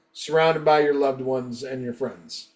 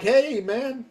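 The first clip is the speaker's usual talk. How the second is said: retold placating protest; 7 semitones higher than usual. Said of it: "and I'm like".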